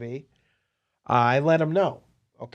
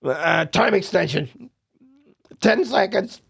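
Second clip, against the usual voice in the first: mock dealer call; 6 semitones higher, 3 dB louder, slower than usual. Dealer calling the shot clock.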